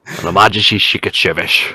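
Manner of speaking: Scottish screen-spy impression with shushed S sounds; Sean Connery. Showing off a Bond title to a friend, in a slurred Scottish impression. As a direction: slur every S into "sh". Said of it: "that's Sean Connery".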